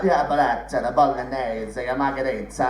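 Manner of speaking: slow, hammed-up Italian accent on the dish names; mock Italian accent. Mum ordering dishes in a mock Italian accent. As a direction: drag out the Italian food words. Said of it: "who does the accent of the food".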